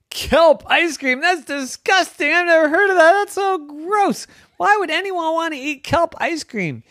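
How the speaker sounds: high, loud grossed-out voice; disgusted shop owner. Acted. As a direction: recoil at the idea.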